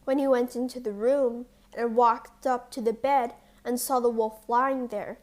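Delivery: ordinary delivery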